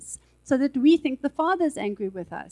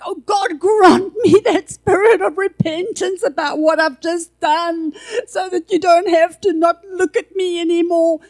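desperate pleading; 5 semitones higher, 9 dB louder than usual. Retold or imitated. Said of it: "and was like".

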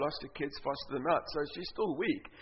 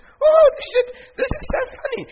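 much higher, loud, laughing through it; laughing woman; 13 dB louder than usual. Retold or imitated. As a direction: squeal it out giggling like the healed woman.

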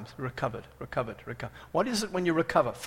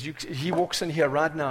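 normal talking voice

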